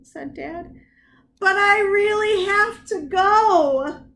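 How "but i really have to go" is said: high, loud sing-song wail; sing-song child whine. Acted. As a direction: wail it like a desperate kid. Child wailing.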